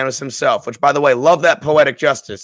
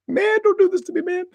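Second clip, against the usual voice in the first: much higher falsetto plea; emotional plea. Retold or imitated. Reacting to the death scene in a high choked falsetto. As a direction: squeak it out, overcome. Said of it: "I started getting emotional".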